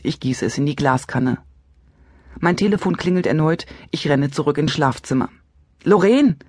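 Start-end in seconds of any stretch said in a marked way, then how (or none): 5.8-6.4 außer Atem vom Rennen, laut und hoch; keuchend. A narrator's reading. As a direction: keuch den Namen atemlos. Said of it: "keuche ich in den Hörer". (Erzählerin keucht nach dem Rennen in den Hörer)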